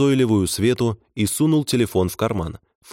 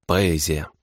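understated sarcastic praise; through clenched teeth; 3 semitones lower than usual. A narrator's reading. Disgusted man mocks his companion's eating, through clenched teeth. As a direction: squeeze out the compliment with distaste. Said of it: "процедил Павел Арнольдович сквозь стиснутые зубы".

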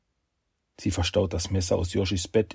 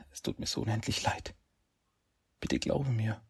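tearful pleading; 6 semitones higher than usual. Acted. Heroine begs forgiveness, kneeling.

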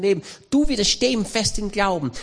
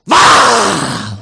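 huge roaring lion yell; 12 dB louder than usual. Shown as a demonstration. Preacher shows the congregation how the lion roars, a loud roar. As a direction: roar it out to scare the prey. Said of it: "plötzlich macht er Folgendes".

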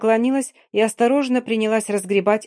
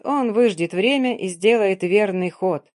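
ordinary delivery

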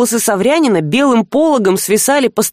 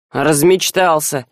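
grumbling; 7 semitones lower, slower than usual. A narrator's reading. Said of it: "проворчала с печи".

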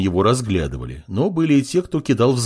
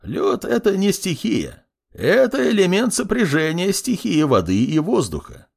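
put-on high-pitched old clerk lecturing; instructive, raised old-man voice; didactic correction; 9 semitones higher than usual. A narrator's reading. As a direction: correct him like a pedantic teacher. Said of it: "назидательно произнёс принимающий заявление".

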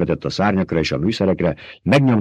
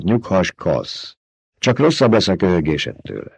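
ordinary delivery

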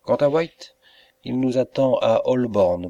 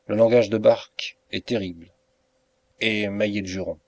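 ordinary delivery

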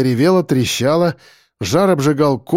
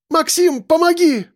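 very high, strained plea; woman's cry. A narrator's reading. Injured Яна calling Максим for help, high cry. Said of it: "крикнула Яна".

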